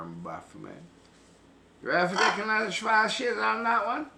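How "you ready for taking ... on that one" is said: hyped shout. Real, not acted. Hyped rant at camera.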